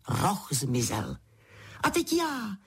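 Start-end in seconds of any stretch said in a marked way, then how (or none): 1.8-2.6 high-pitched, eager royal cry; exclaiming. A narrator's reading. Queen demands her turn with Pedro, exclaiming. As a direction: call out to be next. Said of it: "zvolala královna".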